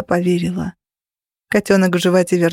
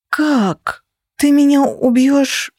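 high, drawn-out plaintive whine; whimpering; 6 semitones higher, much slower than usual. A narrator's reading. Heroine asks whether he will kill her, whimpering. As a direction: whimper the question in a raised voice. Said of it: "прохныкала я".

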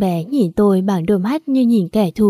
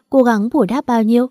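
ordinary delivery

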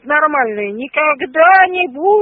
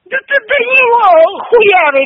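angry outburst; 6 semitones higher, 5 dB louder than usual. Real, not acted. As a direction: voice breaking into a furious cry. Angry row on the phone. (срывающийся злой крик)

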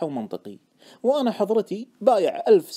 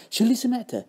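raised, eager question; keenly interested; 6 semitones higher than usual. Acted. Friend fishing for a tip on the stock, keenly interested. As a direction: ask with keen interest. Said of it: "سألني باهتمام".

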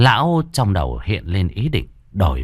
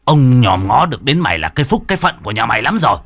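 louder, faster angry tirade building to a roar; furious shouting; 3 semitones higher, 4 dB louder than usual. Acted. Old Khâm yelling at the servant girl who kicked him, furious shout. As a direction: berate her, rising to a fast shout. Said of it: "Lão hét vào mặt con Thắm".

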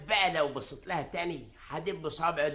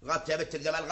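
ordinary delivery

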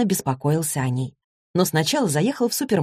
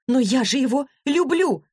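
mild cry out; 8 semitones higher than usual. A narrator's reading. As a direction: cry it out in a raised pitch. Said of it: "выкрикнула девушка".

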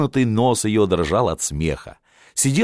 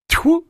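a sharp high mock spit, much higher; spitting sound. Shubin spits in disgust at the tsarevna's teasing, a sharp high spit. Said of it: "сплюнул Шубин".

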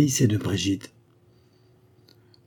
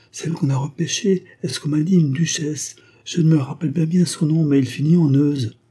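higher, chattering peasant woman; village woman; 6 semitones higher than usual. A narrator's reading. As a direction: rattle it off, unaware of the blow. Village woman names the duchess.

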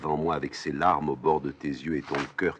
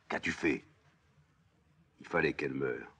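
ordinary talk

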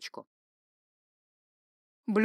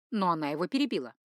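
plain reading voice

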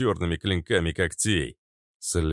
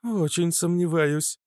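plain reading voice

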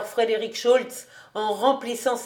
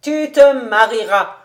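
loud, nasal, ironic prediction; ironic nasal taunt; 3 semitones higher, 9 dB louder than usual. A narrator's reading. Old rabbi predicts Kobus's marriage, ironic and nasal. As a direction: say it through the nose, loud and taunting. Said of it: "en nasillant d'un air ironique".

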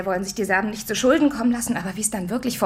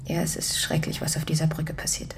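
hushed whisper; 4 semitones lower, 4 dB quieter than usual. Acted. Grim phone talk about bridge.

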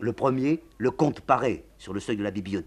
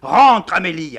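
shouted, much higher and louder; loud order; 11 semitones higher, 17 dB louder, slower than usual. Acted. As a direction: bark the command. General orders her in.

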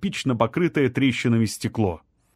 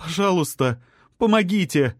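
frightened plea; 6 semitones higher than usual. A narrator's reading. Trapped woman begging.

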